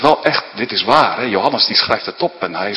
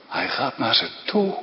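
ordinary delivery

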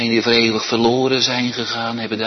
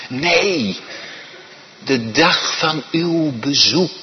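normal talking voice

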